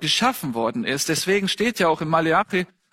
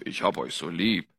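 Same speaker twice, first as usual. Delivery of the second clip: much lower and softer, a deep rumble; 14 semitones lower, 6 dB quieter than usual. Shown as a demonstration. Preacher shows the deep voice against the loving one, low and soft. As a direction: drop the voice way down as the contrast. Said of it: "die ist da nicht so tief".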